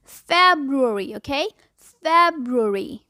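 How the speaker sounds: फरवरी as feb-ru-ary, very slow; slow correct February. Shown as a demonstration. Teacher insists on the right February, slowly. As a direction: draw out फरवरी syllable by syllable. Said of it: "फरवरी कहना है बस".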